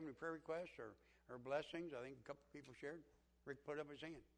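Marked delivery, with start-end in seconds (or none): none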